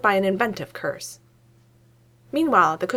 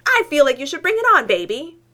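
high, loud taunting shriek; 8 semitones higher, 6 dB louder than usual. A narrator's reading. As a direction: shriek it back, half out of the chair. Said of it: "Newton shrieks".

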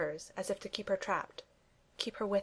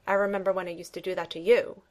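louder, pointed mock reproach; glaring; 8 dB louder than usual. A narrator's reading. Bucky needles Steve about the old scares, louder and pointed. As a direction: needle him with a glare. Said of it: "says Bucky, glaring".

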